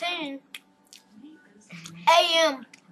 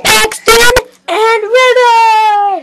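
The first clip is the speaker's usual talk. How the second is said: long, loud, stretched host introduction; drawn-out announcer shout; 7 semitones higher, 13 dB louder, much slower than usual. Acted. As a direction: call the names out like a showman. Host announcing his special guests.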